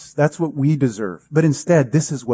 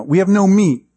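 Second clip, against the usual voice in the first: high, louder mock gripe; pitched-up, insistent acted complaint; complaining; 6 semitones higher, 5 dB louder than usual. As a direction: imitate a crowd demanding more. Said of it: "They complain".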